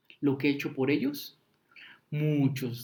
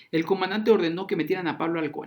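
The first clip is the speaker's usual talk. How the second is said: ordinary talk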